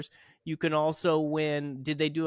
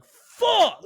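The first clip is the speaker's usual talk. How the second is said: high, loud hammed-up curse; mock outraged yell; 10 dB louder than usual. Man imagining Smokey Bear losing a raffle, mock outrage. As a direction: yell it way up high in comic fury. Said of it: "and he's like".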